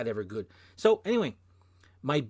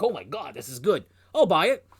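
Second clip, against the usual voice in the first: retold excitement; 5 semitones higher, 9 dB louder than usual. Retells thrilled reaction in record store.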